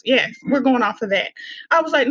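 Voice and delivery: ordinary talk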